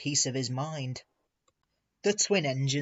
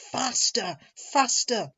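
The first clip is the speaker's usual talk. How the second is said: urgent, raised grumble; grumbling; 9 semitones higher, 5 dB louder, slower than usual. A narrator's reading. Loaded trucks egging each other on downhill, grumbling. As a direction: grumble it higher, urging more speed. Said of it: "they grumbled".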